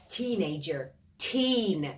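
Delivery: تینیجر با تاکید روی تین; stress on teen. Shown as a demonstration. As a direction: hit the teen syllable hard. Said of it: "تلفظم تاکیدم روی تین هست". (تاکید روی «تین»)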